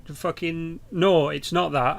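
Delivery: ordinary talk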